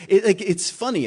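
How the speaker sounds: ordinary delivery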